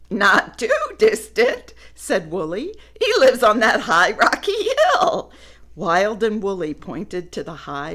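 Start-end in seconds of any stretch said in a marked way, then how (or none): 0.1-5.3 giggly and bright; amused explanation; laughing. Acted. Woolly jokes.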